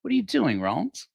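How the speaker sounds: imitating a shocked companion's question. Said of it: "My companion was shocked".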